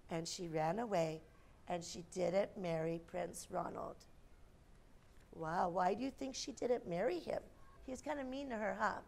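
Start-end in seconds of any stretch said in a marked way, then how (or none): none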